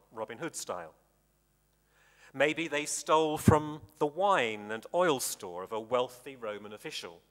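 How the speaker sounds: ordinary talk, nothing marked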